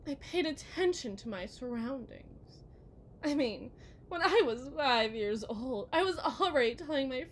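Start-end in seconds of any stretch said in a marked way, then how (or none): none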